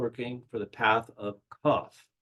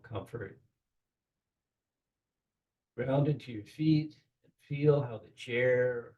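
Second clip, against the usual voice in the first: guided meditation. Acted. Guiding the group through the Kabbalistic cross, in a calm meditation voice. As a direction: lead the listeners gently into the ritual.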